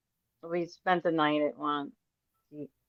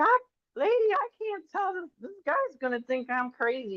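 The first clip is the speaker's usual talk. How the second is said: flustered high protest; 12 semitones higher than usual. Retold or imitated. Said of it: "I'm like".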